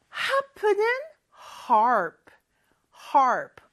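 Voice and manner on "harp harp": "harp" louder with a clear English R; loud demo harp with R. Shown as a demonstration. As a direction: roll into the R of "harp". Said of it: "R 발음 잘 넣어주시고요".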